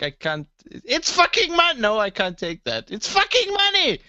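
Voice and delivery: high, loud mock indignation; mock outraged clerk. Shop clerk refusing a big note, mock outraged. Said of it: "and they're like".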